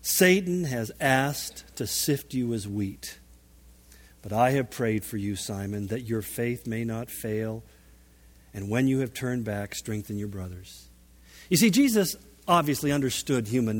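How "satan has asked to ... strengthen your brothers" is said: low, softened, measured counsel; quiet earnest warning, sinking softer toward the end; tender, grave assurance. Retold or imitated. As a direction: lower and hush it as you reassure a friend. Preacher voices Jesus gently warning Simon.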